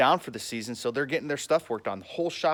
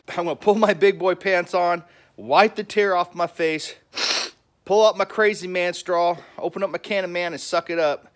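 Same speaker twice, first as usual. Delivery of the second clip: loud, higher, hammed-up resolve; mock-macho pep talk; 5 semitones higher, 7 dB louder than usual. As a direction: psych yourself up with comic bravado, loudly.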